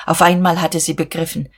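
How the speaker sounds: plain reading voice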